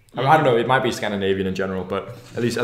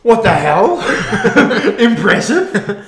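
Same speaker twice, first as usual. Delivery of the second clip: laughing in loud, high disbelief; shocked and cracking up; surprised laughing; 9 semitones higher, 8 dB louder, slower than usual. Real, not acted. Surprised by a second fish.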